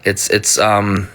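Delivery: stammering, stuck on words; stuttering. Real, not acted. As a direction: trip over the words. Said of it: "I'm stutter".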